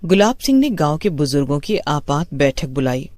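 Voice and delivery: plain reading voice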